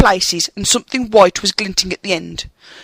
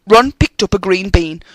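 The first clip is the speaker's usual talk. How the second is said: ordinary delivery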